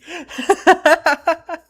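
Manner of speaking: high, loud laugh of embarrassment; high embarrassed laugh. Real, not acted. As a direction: laugh it off, a bit flustered. Embarrassed laugh after sappy moment.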